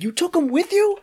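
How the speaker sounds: incredulous. Retold or imitated. Boss on phone to manager, squeaking in disbelief.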